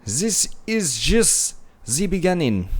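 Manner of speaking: put-on hammy German accent for the Fuhrer's quote; bad German accent. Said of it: "my German accent is very bad".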